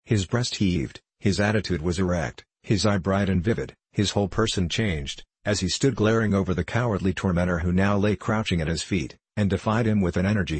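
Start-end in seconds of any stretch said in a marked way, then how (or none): none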